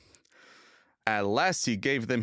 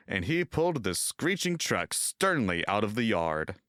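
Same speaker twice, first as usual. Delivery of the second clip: ordinary delivery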